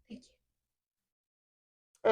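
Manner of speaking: hushed, unvoiced reply as if after a gasp; tiny whispered thanks; whispered quote. Retold awed reply in recap.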